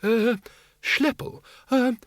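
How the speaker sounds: put-on high, hesitant old man's quaver. A narrator's reading. Windle nervously asking the bogeyman to clear the way, quavering. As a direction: ask timidly in a high quaver. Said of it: "Windle quavered".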